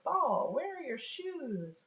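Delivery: high, drawn-out sing-song; playful wondering. Acted. Parent quizzes child at blanket game, playful wondering. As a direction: make a game of the question.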